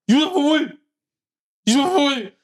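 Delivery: high loud screamed vocal; grindcore screech. Retold or imitated. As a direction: shriek it like the singer. Imitating the song's vocals.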